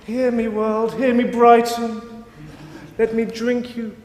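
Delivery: high, ringing mock-grand invocation; hammed-up, high-pitched proclamation; pompous declaration. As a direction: call out to the world like a self-important performance artist. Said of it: "making pompous declarations".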